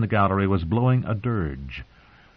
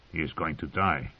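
much softer, low inner voice; quiet realization; 6 semitones lower, 9 dB quieter than usual. Acted. Tyrion realizes to himself, low and soft. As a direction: think it aloud, hushed. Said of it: "Tyrion realized".

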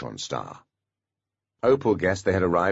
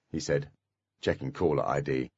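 ordinary delivery, nothing marked

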